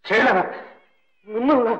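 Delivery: repeating the word in disbelief; hurt disbelief. Acted. Doctor hurt by the accusation. (నొచ్చుకున్న ఆశ్చర్యంతో)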